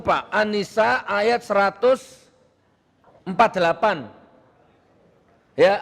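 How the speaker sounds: ordinary delivery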